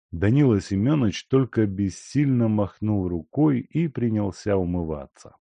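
ordinary delivery

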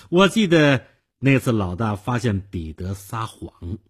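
usual speaking voice

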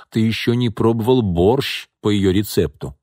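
ordinary delivery

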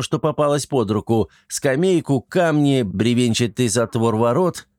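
plain reading voice

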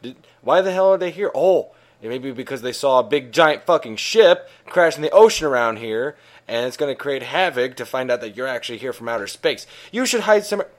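loud, agitated acted old man; alarmed outburst. Acts old man alarmed, urging hiding.

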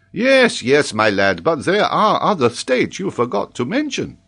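loud, high, excitable scholar; worked-up teacher objecting; impatient professor. Acted. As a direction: press the pupil insistently. Paganel impatiently presses the child.

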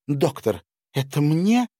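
high, delighted question; pleasantly surprised. A narrator's reading. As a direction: ask with wondering delight. Удивлённый вопрос графини. (удивлённо-радостный вопрос)

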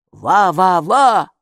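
dog barking. A narrator's reading. The dog Eku barking in the bushes. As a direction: bark like a small dog.

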